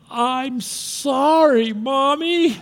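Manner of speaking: put-on high, slow, small contrite child voice; little girl apologizing to mother; sorry little girl. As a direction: apologize like a five-year-old. Remorseful child voiced in story.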